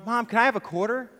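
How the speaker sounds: lightly voiced little boy, pleading; a small boy asking mom for money. Boy asks his mom for a quarter, lightly childlike.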